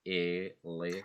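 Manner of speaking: "E, le" split with clear gaps; teacher chopping out "E... le..."; syllable by syllable. Shown as a demonstration. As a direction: break the word into separate syllables. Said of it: "separate them using syllables".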